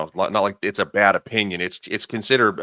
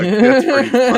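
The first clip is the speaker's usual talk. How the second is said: real laughter through the words, much higher; laughing; 8 dB louder than usual. Real, not acted. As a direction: burst out laughing while talking. Laughing at the parrot joke.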